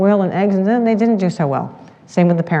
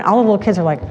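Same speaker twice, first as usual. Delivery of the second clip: ordinary talk, nothing marked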